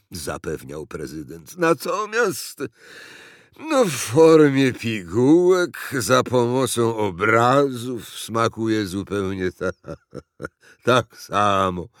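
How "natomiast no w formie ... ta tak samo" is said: slow, halting, ponderous character voice; weary fat president. A narrator's reading. President explains pills to uncle, slow and weary. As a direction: labour through it, stammering.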